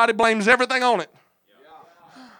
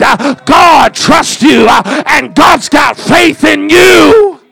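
shouted, drawn-out revival preaching; loud, slow-building preacher cadence; full-force preaching; 5 semitones higher, 16 dB louder than usual. Real, not acted. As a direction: shout each phrase to the congregation, punctuated with "uh".

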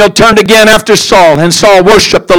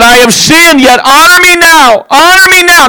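much higher, begging demand; pleading Saul; 9 semitones higher than usual. Acted. Saul crying out to Samuel for honour, high pleading. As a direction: plead for honour like a cornered king. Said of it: "he said".

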